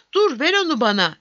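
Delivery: calling out. A narrator's reading. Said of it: "diye seslendi".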